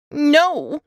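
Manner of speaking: put-on a cross little girl pushing away; toddler's no. Toddler refuses stranger.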